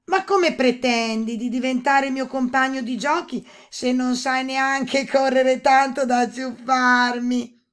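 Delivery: high, loud taunt; mocking princess shout. A narrator's reading. Princess jeering at the slow toad, loud mocking call. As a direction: shout teasingly back at the toad. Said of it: "gridò la principessa ridendo di gusto".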